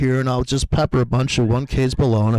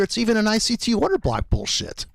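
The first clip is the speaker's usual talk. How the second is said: normal talking voice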